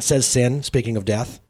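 plain reading voice

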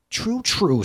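a touch of a high screech; Sin agrees with a shrill edge. Acted. Sin screeches agreement at the carpenter, mildly. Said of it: "screeched Sin".

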